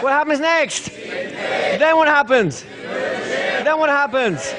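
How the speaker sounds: high, insistent pretend panic; mock freak-out. Trainer pressing the class for each next step, mock freak-out. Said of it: "I'm freaking out".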